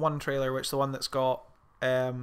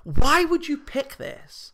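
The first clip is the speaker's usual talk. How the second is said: high, drawn-out exasperated question; incredulous; 10 semitones higher, 4 dB louder, slower than usual. Acted. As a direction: plead in slow, high disbelief. Incredulous question aimed at the actor.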